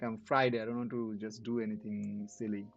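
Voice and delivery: ordinary delivery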